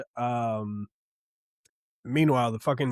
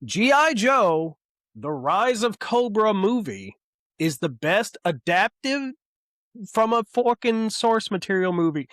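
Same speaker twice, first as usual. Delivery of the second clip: soapbox proclamation; 7 semitones higher than usual. Pretend. Ranting to podcast listeners about a favourite movie, grand proclamation. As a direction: proclaim it like a public speech from a soapbox. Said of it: "I'd like to point out to everyone listening today on my soapbox".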